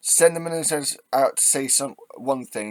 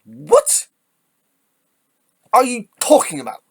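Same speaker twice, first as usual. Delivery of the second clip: incredulous yell; 10 semitones higher, 7 dB louder than usual. Real, not acted. Commentator rants at coalition of chaos claim, incredulous yell. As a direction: shout it in sheer exasperation. Yelling in exasperation at politician.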